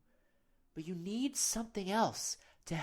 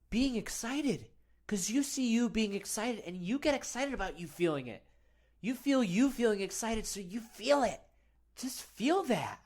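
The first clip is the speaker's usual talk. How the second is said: raised, loud pep-talk excitement; excited hype; 6 semitones higher, 5 dB louder than usual. Pretend. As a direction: pump them up, high and insistent. Hypes listener into happy, excited feelings.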